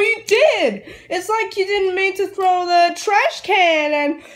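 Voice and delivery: ordinary delivery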